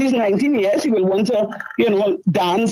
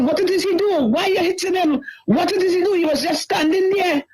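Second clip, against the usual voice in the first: high, pleading cry at the police; retold crying plea; 8 semitones higher than usual. Mother pleading with officers beating her son, crying high. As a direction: voice pitched high, begging through tears. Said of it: "I am bawling and I am crying".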